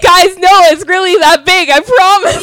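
high laughing protest; laughing mock plea. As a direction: squeal it through laughter. Mock quote of boyfriend, laughing.

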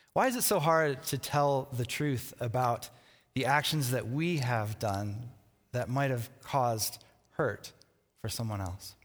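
ordinary delivery

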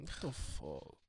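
put-on soft Spanish-accented exasperation. Friend exasperated at refused drink, mimicked Spanish accent. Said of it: "this is a great accent".

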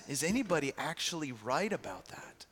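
hushed, unvoiced question; preacher quietly challenging the congregation, whispering; whispered question. Real, not acted. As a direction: lean in and whisper it.

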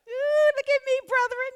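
high, preening show-off voice; boastful Joseph. Acted. Preacher voicing a vain young Joseph showing off his coat. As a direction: prance about in a high, vain brag.

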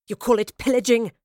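offended queen snapping at her captive; snapped. A narrator's reading. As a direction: bite back at the word pillaging. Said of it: "snapped Boudica".

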